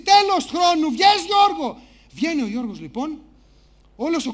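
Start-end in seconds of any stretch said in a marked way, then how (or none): 0.0-1.8 loud, high host's call; host shouting. Acted. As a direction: shout it out like a show presenter. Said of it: "φωνάζει ο παρουσιαστής".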